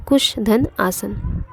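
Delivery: कुश धन आसन said very slowly, part by part; slow recap. Shown as a demonstration. Teacher to students repeating the split, very slowly. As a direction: drag out कुश धन आसन so it can be written down. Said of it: "संधि विच्छेद करें तो".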